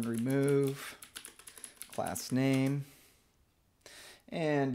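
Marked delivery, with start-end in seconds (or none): none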